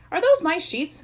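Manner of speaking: put-on affronted boy's challenge; demanding. A narrator's reading. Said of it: "Mike demanded of the hidden presence".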